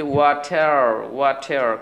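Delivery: ওয়াটার with a t and a strong final r; clearly pronounced r. Shown as a demonstration. Teacher explaining American water, ওয়াটার with r heard. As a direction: say ওয়াটার slowly, sounding the r. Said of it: "পরিষ্কার করে বলে".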